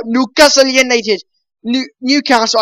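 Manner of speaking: put-on Geordie accent, lifted at the end. Shown as a demonstration. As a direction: play up the Geordie accent on the club's name. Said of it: "I like to say that Newcastle United in a very nice Geordie accent".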